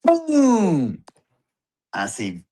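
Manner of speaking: ordinary talk, nothing marked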